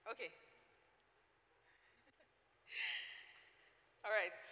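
ordinary delivery

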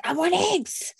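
put-on high childish demand, "I want eggs"; whiny child. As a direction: mimic a small boy insisting. Son voiced demanding eggs.

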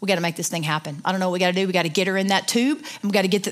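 low, businesslike husband impression; David's way of talking. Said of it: "that's how he talks".

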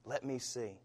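soft, gentle plea; God's faint voice calling softly. Acted. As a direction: hush it like a distant call. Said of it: "a faint voice".

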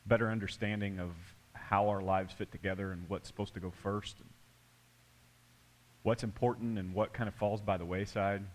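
soft, low opening prayer; hushed pastor's prayer. Acted. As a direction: pray quietly over the congregation.

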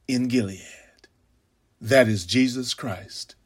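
whispered close to the mic; hushed. Acted. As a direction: let it fall to a whisper. Preacher drops to a hush.

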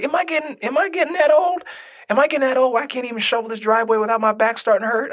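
very high-pitched incredulous self-questioning; mock dismay. Mock dismay at getting old.

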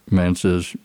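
ordinary talk

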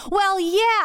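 high, sharp retort; snapped back. Retold or imitated. Woman answering her lover's insult, snapping back. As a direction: fire it back, pitch up. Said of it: "I snapped back".

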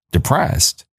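high, stunned echo of the word; incredulous. Real, not acted. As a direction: repeat it in disbelief. Stunned at friend's verdict.

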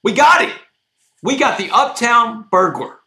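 high, loud triumphant call; retold shout. Cop shouting to his team, loud and high. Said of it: "one of these cops screams out, out loud".

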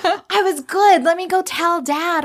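put-on high, slow little-boy voice; child voice. The son tells his mother he did well, childlike and high. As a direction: play an eager small boy.

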